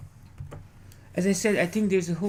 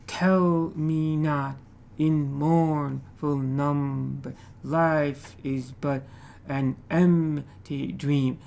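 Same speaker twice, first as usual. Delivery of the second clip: "Tell me not in mournful number, life is but an empty dream" in slow, separated beats; slow metrical reading with drawn-out stressed syllables; measured verse rhythm; much slower than usual. Shown as a demonstration. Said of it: "has a certain rhythm, right? It's, it flows".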